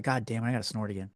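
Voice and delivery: ordinary delivery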